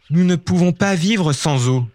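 tearful mother. Acted. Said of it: "éclate en sanglots".

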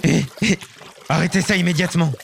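high, fast yelps and protest; yelping giant. Acted. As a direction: squeal as if being nibbled. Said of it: "Le géant gigote en criant".

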